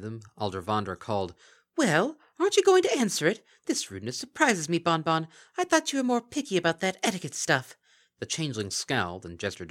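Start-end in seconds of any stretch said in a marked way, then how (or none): 1.8-7.8 high, loud, sly creature voice; mocking Kelpie. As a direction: purr false praise like a gleeful monster. Kelpie taunting Bon Bon.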